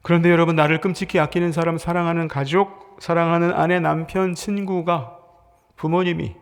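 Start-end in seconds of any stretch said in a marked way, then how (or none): none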